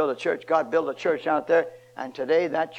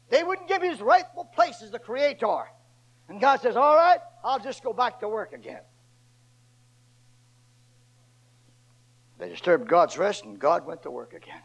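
full-force preaching; 8 semitones higher than usual. Acted. Preacher to his congregation, at full force.

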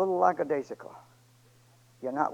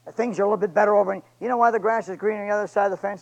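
loud, fast pleading sermon; fervent preaching; 4 semitones higher, 6 dB louder than usual. Acted. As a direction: press the church hard, voice raised. Pleads with congregation at full force.

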